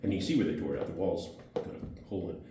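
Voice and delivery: usual speaking voice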